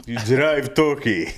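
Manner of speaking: slow mock Russian accent. Shown as a demonstration. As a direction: say the slang like a Russian, laughing. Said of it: "I would need that in a Russian accent".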